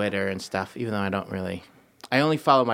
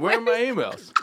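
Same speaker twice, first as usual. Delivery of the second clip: Derek voice; 9 semitones higher than usual. Pretend. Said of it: "And you play Derek".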